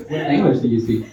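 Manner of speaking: ordinary talk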